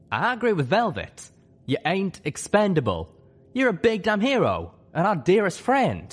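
high, emphatic character voice, heartfelt praise; Calamity, earnest friend. Acted.

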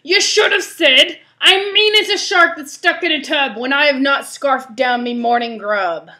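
loud, high, rough pirate voice; pirate cry. Acted. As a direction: cry out the correction as a pirate captain. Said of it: "Pete cried".

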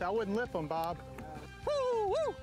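ordinary delivery

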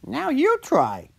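high, far-from-natural cartoon voice, drawn out; encouraging Slurpee. A narrator's reading. Slurpee invites the giant to try, high encouraging voice. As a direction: cheer them on in a high, slow character voice. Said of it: "encouraged Slurpee".

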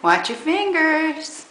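slow, drawn-out caution; slow gentle warning. Real, not acted.

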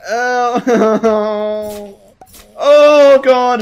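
high loud yelling; panicked shout. Real, not acted. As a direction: cry out in panic. Grabbed in game, shouting.

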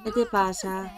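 ordinary talk

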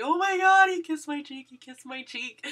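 high, loud squealing oh my God, then settling; excited squeal. Retold or imitated. Fan telling everyone in the hall, squealing with excitement. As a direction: burst out like a giddy teenager.

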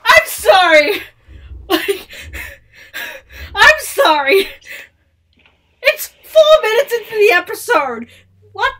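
loud and high, half crying; tearful excited yelling. Real, not acted. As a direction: yell the apology, still overwhelmed. Apologizing while still overexcited.

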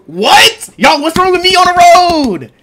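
high, loud indignant yell; outraged shout. Real, not acted. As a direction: yell in disbelief. Outraged at the verdict.

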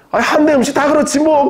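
충청도 주인처럼 높고 무심하게 툭 던지는 말투; 시큰둥하게, 높은 음으로 툭; 무뚝뚝하게. Retold or imitated. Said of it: "무뚝뚝하게 했다는 얘기입니다". (충청도 식당 주인 흉내, 높게 무뚝뚝한 말투)

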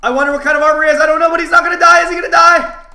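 very high, loud panicked shriek; frantic screaming. Real, not acted. Player screaming as his opponent won't die, frantic. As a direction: scream it all out in one frantic stream.

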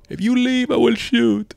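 high-pitched German villain voice; commander's threat in a put-on accent; Rick Toffman. Commander warning an escaping prisoner, character voice. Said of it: "some Rick Toffman voice".

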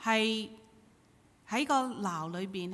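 ordinary talk, nothing marked

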